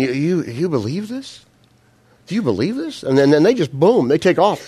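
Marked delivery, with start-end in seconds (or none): 0.0-3.0 disbelief. Retold or imitated. Soldiers watching Goliath fall, stunned disbelief. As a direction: ask it like you can't believe your eyes. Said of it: "they're going like".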